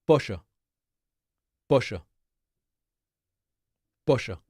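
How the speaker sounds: pollo said as PO-sha with a sh sound for the ll; Argentinian pronunciation. Shown as a demonstration. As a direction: Rioplatense pollo, ll pronounced like English sh. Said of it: "the way you say chicken is".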